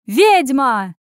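high, loud accusing yell. A narrator's reading. Waiter flattened by the falling girl, yelling. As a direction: yell it out in outrage. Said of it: "завопил Герах".

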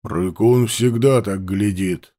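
low, slow hunter; gruff man voice. Acted. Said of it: "Aurang voiced by narrator".

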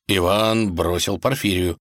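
ordinary delivery, nothing marked

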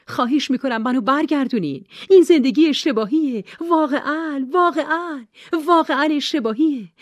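high, loud terrified begging; desperate, frightened screaming; screaming plea. A narrator's reading. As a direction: shriek for rescue as the bear closes in. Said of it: "نورا جیغ کشید".

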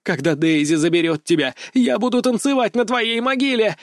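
high, crying rage; furious cry. A narrator's reading. Chained vampire curses the captain: high crying rage. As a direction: scream the vow through tears.

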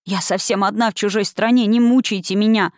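usual speaking voice

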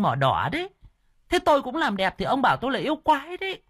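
a wife ticking off her foolish husband; high exasperated woman voice; indignant wife voice. Acted. Wife defends her cosmetic tattoos.

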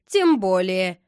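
loud, cold brush-off; cold reply. A narrator's reading. Boy brushing off his friend's excuse on the phone, coldly. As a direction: answer coldly, giving nothing. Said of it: "холодно ответил Рома".

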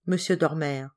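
ordinary delivery, nothing marked

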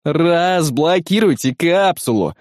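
urgent demand. A narrator's reading. Scofield demanding the capsule be opened, high drawn-out order. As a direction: order it high, stretching the words. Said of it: "выпалил Скофилд".

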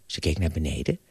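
plain reading voice

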